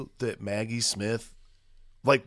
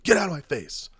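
retold yell; 7 semitones higher, 4 dB louder than usual. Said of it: "I fucking yelled at him".

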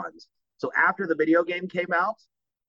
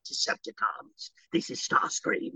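low, slow whispered rasp; quiet, menacing villain rasp; Starscream; 4 semitones lower, 6 dB quieter, slower than usual. Shown as a demonstration. Actor offering producers his new take, hushed raspy villain. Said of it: "in that moment, I went".

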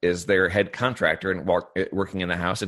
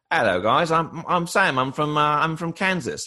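high-pitched put-on British accent; fake British accent; 7 semitones higher than usual. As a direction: imitate a student faking a British accent. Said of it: "what happened to your voice".

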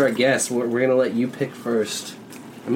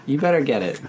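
ordinary talk

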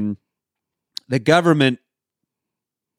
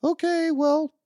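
very high, put-on cheery wife voice; hammed-up high-pitched perky announcement; trying to be chipper. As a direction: imitate a wife forcing bright cheer. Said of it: "she's trying to be, trying to be chipper".

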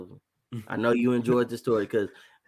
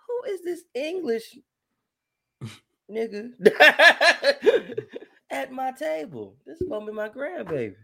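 very high laughing incredulous grandma; baffled grandma; 11 semitones higher than usual. Retold or imitated. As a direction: squeal it like a stunned grandma. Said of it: "I was like".

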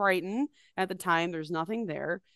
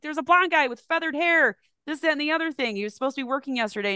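higher and louder, fast and pushy; eager insisting; 8 semitones higher, 6 dB louder, faster than usual. Retold or imitated. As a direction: rush it out, she has to find him. Her insisting on the phone.

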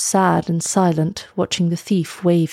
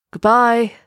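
raised, higher farewell call; called-out goodbye; 5 semitones higher, 4 dB louder than usual. A narrator's reading. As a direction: call goodbye across the beach. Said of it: "he called".

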